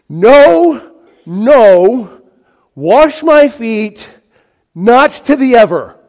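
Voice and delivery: loud, high, spaced-out refusal; very emphatic. Acted. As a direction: hammer each phrase as an absolute no. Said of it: "it's very emphatic. He says".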